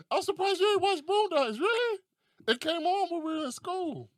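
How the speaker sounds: very high-pitched, put-on amazement; exaggerated surprise. Reacting to someone who never watched Boondocks, exaggerated surprise. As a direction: squeak with comic disbelief. Acts out surprise at unwatched show.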